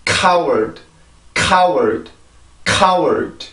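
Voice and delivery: 카워드 as English "coward", puffed k and falling; pronunciation drill. Shown as a demonstration. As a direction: stress the first part of 카워드, clipping the final d. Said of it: "다시 한번".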